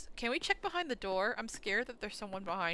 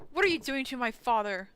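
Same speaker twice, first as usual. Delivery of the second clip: louder, stress on are; loud demand; 3 semitones higher, 9 dB louder than usual. Real, not acted. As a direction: demand it protectively. Confronting the figure.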